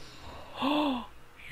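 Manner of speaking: high sudden gasp of shock; sharp gasp. Real, not acted. Gamer watching his lemmings die, sharp gasp. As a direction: gasp as the bombs go off.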